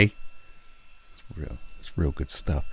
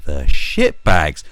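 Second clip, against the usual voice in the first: slow, much louder angry outburst; mock outrage; 2 semitones lower, 12 dB louder, much slower than usual. Ranting about the police taking rights away, slow loud mock fury. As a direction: spit out the insult in mock fury. Conspiracy rant climax.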